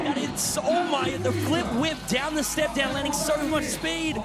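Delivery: high, shouted play-by-play; excited live commentary. Real, not acted. Commentator calling the flip whip, thrilled high shout. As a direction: tumble over the words in amazement.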